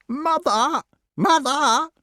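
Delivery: very high, harsh bird scream; piercing crow alarm. A narrator's reading. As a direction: shriek for help like an alarmed crow. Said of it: "screamed the crow".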